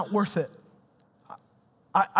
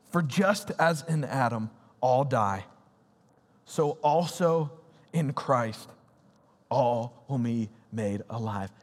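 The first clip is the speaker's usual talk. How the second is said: slow, lower, solemn reading of the verse; scripture reading; 6 semitones lower, slower than usual. Acted. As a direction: read it out slowly with reverence. Reads scripture aloud.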